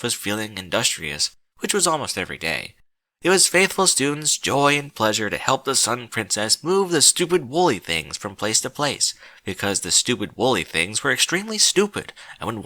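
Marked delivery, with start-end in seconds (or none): none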